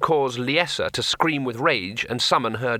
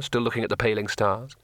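ordinary delivery, nothing marked

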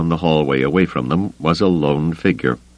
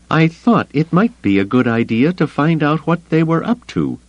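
higher, sarcastic retort; sarcastic growl; 11 semitones higher than usual. Acted. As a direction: bite off a sarcastic answer. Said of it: "she growled sarcastically".

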